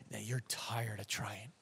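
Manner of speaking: breathy whisper, slow and very soft; hushed whisper. Acted. Pastor to weary listeners, whispered slowly.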